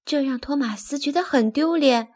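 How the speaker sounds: ordinary delivery, nothing marked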